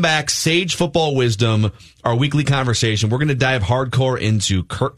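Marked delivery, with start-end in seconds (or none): none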